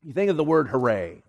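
ordinary talk

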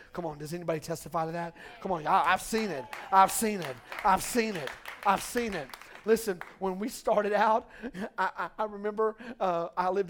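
loud, high full-force preaching. Acted. Pastor rousing the church, loud full-force preaching. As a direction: preach it out to the congregation.

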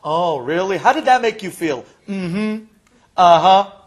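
loud, mocking show of interest; mimicked therapist. Patient mimicking the doctor's replies, mocking therapist. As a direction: imitate a therapist's stock responses, exaggerated and loud. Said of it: "All I heard from you".

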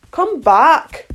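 high, loud call; calling after someone. A narrator's reading. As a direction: call her back as she leaves. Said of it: "the caterpillar called after her".

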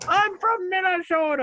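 high mock girlfriend voice; imitating girlfriend. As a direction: squeak it out like a playful tease. Imitating girlfriend's call-out line.

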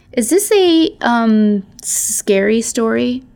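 high little voice, slowing down; nervous hesitation. A narrator's reading. Runt having second thoughts about staying, nervously asking. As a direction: ask high and haltingly, unsure.

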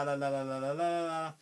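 ordinary delivery

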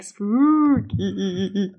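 "spooky" drawn out slow and eerie. Shown as a demonstration. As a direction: croon "spooky" like a haunted house. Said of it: "you have to say it, like".

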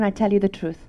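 ordinary talk, nothing marked

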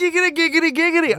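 high, playful repeated catchphrase; squeaky silly repetition; silly noises. Pretend. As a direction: make goofy high-pitched noises. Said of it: "Let's make some more noises".

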